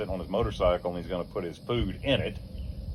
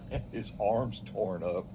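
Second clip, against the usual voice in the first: ordinary delivery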